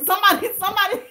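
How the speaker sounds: squealing with laughter, high and loud; can't get the words out for laughing; laughing at a viewer's comment. Real, not acted. Reading a funny live comment.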